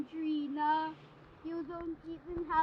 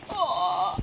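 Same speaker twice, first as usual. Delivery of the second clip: low, drawn-out ghostly oh; low spooky oh; 9 semitones lower, 4 dB louder than usual. Acted. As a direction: drop the voice low and eerie. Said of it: "I don't like my voice when you say that".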